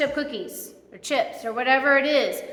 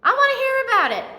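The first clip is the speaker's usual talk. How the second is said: much higher, eager and inviting, tumbling out fast; welcoming God; 10 semitones higher than usual. Retold or imitated. Preacher voicing God welcoming a hurting child, higher and eager. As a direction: open your arms and pull them in with the words. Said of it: "He's like".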